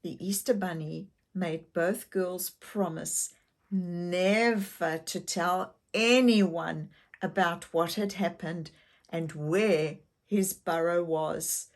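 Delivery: ordinary delivery, nothing marked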